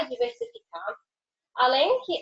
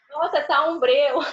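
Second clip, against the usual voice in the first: laughing; 5 semitones higher, 5 dB louder than usual. Real, not acted. Repeating the local expression, laughing.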